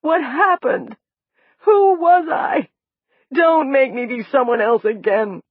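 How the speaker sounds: high, loud frightened cries; rising panic, voice pushed high; panicked. A narrator's reading. Amnesiac woman pleading not to be someone else, panicked.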